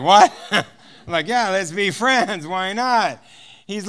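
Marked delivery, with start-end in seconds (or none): 0.0-0.3 startled what. Retold or imitated. Guard startled by friendship offer. 1.3-3.2 laughing invite. Retold or imitated. Cheerful insistence, laugh heard.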